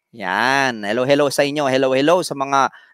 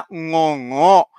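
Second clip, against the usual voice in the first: nasal voice. Shown as a demonstration. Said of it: "Ngongo nga siya".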